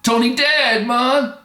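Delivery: put-on Jamaican accent, blurted out high and loud; blunt Jamaican reply. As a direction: drop the news bluntly, in Jamaican. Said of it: "it abruptly comes".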